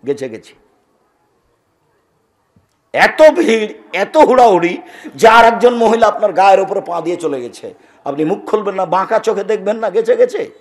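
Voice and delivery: ordinary talk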